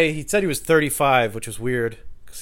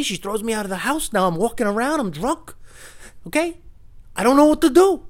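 imitating the drunk guy; 9 semitones higher than usual. Voicing the drunk guy's sob story.

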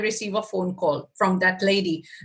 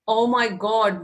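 usual speaking voice

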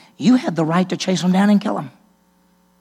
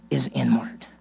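whisper; 4 semitones lower, 5 dB quieter than usual. Acted. Emphatic sermon point dropped to whisper.

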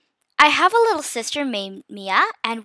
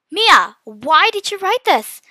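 high, loud, slow worried cry; worried shout; 6 semitones higher, 7 dB louder, slower than usual. A narrator's reading. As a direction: shout at your sister in alarm. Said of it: "I shouted worriedly".

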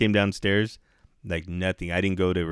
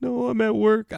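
high whining imitation; retold crying; 14 semitones higher than usual. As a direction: imitate a tearful answer. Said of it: "she's fucking crying".

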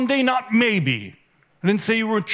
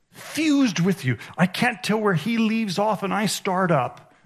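ordinary delivery

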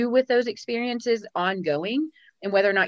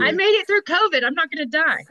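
excited; 9 semitones higher, 7 dB louder than usual. Real, not acted. Joking bravado after choking.

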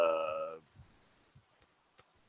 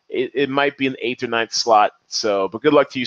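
ordinary talk, nothing marked

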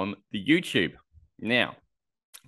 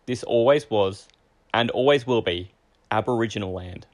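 formal acknowledgement; 4 semitones higher, 6 dB louder than usual. Acted.

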